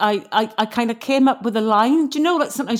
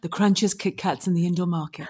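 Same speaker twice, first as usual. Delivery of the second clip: soft, low, seductive Welsh lilt; sexy Welsh accent; 5 semitones lower, 6 dB quieter than usual. Shown as a demonstration. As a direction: purr it in a sultry Welsh voice. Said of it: "you have to say it with a sexy Welsh voice".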